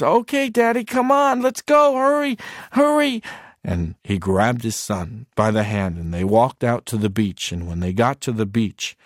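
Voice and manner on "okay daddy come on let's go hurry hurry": put-on high, loud little boy urging; eager child. Son pulling his father to the beach, high and eager. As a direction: hurry dad along like an impatient kid.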